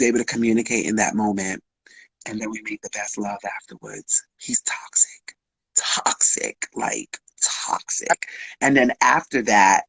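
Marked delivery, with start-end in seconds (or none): 2.3-8.3 hushed, unvoiced confiding whisper. Real, not acted. Telling a podcast host a secret, in a whisper.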